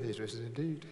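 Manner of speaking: low, quiet deadpan congregational response; mock unenthusiastic. Pastor teasing his congregation's dull Easter response, mock unenthusiastic. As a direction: mumble it back with no excitement at all. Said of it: "such enthusiasm and excitement".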